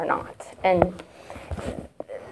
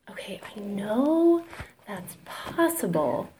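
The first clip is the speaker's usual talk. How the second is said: hushed whisper, slow and drawn out; awed whisper; 8 dB quieter, slower than usual. Retold or imitated. Telling her mother-in-law she believes it, hushed whisper. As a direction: breathe it out in quiet disbelief.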